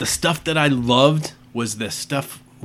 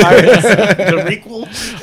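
laughing; 6 semitones higher, 3 dB louder, much slower than usual. Real, not acted. Joking, laughing.

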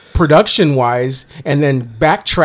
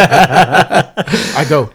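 ordinary talk, nothing marked

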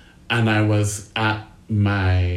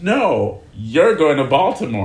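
louder, higher firm insistence; 5 semitones higher, 5 dB louder than usual. Retold or imitated. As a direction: insist out loud. Bosses insist, quoted.

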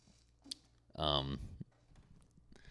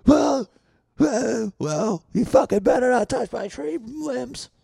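much higher, louder squealing threat; shrill yell; 6 dB louder, slower than usual. Acted. Neighbor shrieking a warning about his tree limbs, high and loud. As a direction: squeal it out in outrage.

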